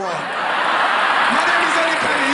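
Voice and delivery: very slow, loud sign-off; name it big to the room; show sign-off. Real, not acted. Comedian closing set to audience, loud slow sign-off. Comic signs off loudly.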